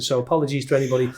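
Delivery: ordinary talk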